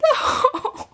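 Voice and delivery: very high, drawn-out mock wail; exaggerated heartbroken squeal; mock crying. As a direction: wail it like a hammed-up sob. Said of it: "I just started crying".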